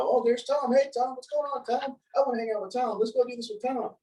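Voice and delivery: high, excited calls for Tom; eager crowd greeting. Retold or imitated. Crowd at the conference eagerly greeting Tom, excited high voice. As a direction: gush over Tom like a popular kid.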